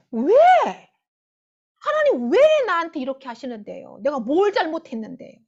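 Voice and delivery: loud, acted-out indignant appeal; resentful protest. Preacher plays a believer demanding answers from God, resentful and irritated. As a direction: demand an explanation as if wronged. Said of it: "원망하고 불평하고 짜증내잖아요".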